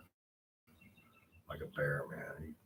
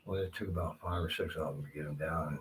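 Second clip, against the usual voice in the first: ordinary talk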